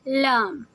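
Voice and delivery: light drawn-out "لام", tongue tip behind upper teeth; gentle Lam. Shown as a demonstration. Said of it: "نرمی سے لگاتے ہیں". ("لام" نرمی سے، زبان مسوڑھوں پر)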